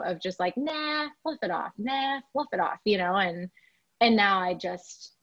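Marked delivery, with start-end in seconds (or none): 0.6-2.8 high "nah" dropping into a casual brush-off; dismissive ex. Retold or imitated. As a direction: lightly imitate someone waving it away. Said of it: "he was also the voice of that".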